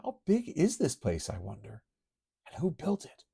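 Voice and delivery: put-on high girlish voice. Kitty falls underground, high girlish voice. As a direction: play the young heroine thinking aloud.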